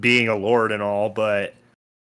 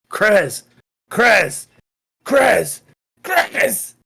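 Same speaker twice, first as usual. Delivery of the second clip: rising repeated calls, each one higher; calling out; 10 semitones higher, 4 dB louder, much slower than usual. Acted. Voice echoing through the tunnels, calling Krez ever higher. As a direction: call the name louder down the tunnels.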